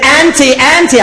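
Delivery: put-on high, loud little boy calling; urgent child calling. Nephew waking his aunt at dawn, high urgent calling. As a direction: call her like a panicked little boy.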